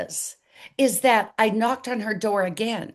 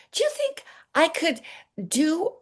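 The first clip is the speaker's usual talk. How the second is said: high, hesitant wondering question; tentative asking; 7 semitones higher than usual. Retold or imitated. Asking her husband about an idea, tentatively. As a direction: float the idea in a raised, unsure voice.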